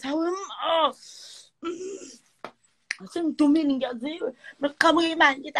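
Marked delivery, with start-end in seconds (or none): 0.0-4.3 naive excitement. Retold or imitated.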